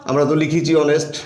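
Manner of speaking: ordinary talk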